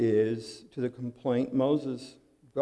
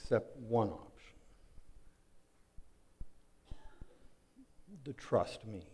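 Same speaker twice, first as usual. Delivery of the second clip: much softer, low and intimate; sudden hush; 4 semitones lower, 15 dB quieter than usual. Acted. God telling Israel to trust him, hushed. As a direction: drop right down, almost confiding.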